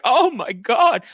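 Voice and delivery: high, loud, slow shocked gasp; hammed-up pearl-clutching shock; mock Lisa gasp. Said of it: "And Lisa's like".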